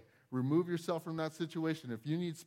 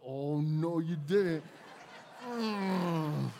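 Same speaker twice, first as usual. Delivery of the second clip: sassy oh no you didn't; 5 dB louder, much slower than usual. Retold or imitated. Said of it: "I'm like".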